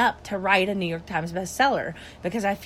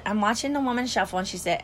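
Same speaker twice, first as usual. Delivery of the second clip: ordinary talk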